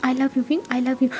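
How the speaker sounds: soft, low confession of love; tender confession. Acted. Wangji softly confessing love to Wing, tender. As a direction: breathe the words gently to him.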